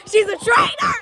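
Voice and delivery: high, loud mock accusation, shouted. Calling her friend a traitor to the listeners, mock shout. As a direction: yell it out like a playground betrayal.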